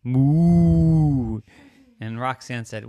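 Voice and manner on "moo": loud, long moo; drawn-out cow call. Acted. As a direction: moo loudly and high. Fairy cow moos.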